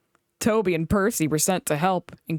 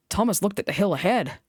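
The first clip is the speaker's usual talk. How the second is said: ordinary delivery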